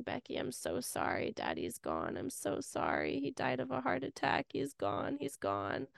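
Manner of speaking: mother breaking bad news through tears; retold tearful phone call. Mom on the phone telling her daughter, hushed and tearful. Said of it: "on the phone just in tears saying".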